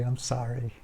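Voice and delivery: usual speaking voice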